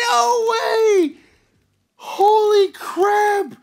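very high, loud squeals of disbelief; real high-pitched amazement; ecstatic shouting. Real, not acted. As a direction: squeal and shout, overwhelmed with joy. Second rare card pulled.

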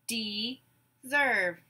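"Deserve" in two drawn-out beats; syllable by syllable. Shown as a demonstration. Teacher gives syllables to blend, "Deserve" broken apart. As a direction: say "Deserve" as de, pause, serve. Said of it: "I will say syllables in a word".

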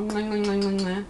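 a string of playful kiss sounds; blown kisses. Acted. Blowing goodbye kisses to all of y'all, playful. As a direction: blow kisses at the camera.

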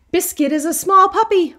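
usual speaking voice

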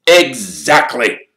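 high, slow, loud captain voice; eager captain. Acted. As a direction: savour the word like a keen captain. Wood answers.

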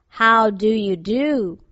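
slow, drawn-out storybook scarecrow; scarecrow's greeting. A narrator's reading. Scarecrow greeting the girl, slow and deliberate. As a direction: stretch out each word of the greeting.

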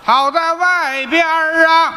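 slow ringing answer; hawker's reply. Acted. Apprentice answers the call, slow and ringing. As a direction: answer the cry in kind. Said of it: "apprentice answers the call".

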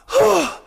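high, heavy sigh of despair; mock sigh. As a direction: sigh like it's hopeless. Voicing a worried patient.

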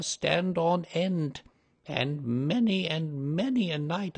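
slow, rising dread of a frightened servant; ominous old housekeeper. A narrator's reading. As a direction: warn them slowly, voice climbing with fear. Housekeeper warns of hauntings.

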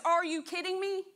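a teacher rebuking his doubting students; incredulous rebuke. Retold or imitated. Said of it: "But teacher looks at them and says".

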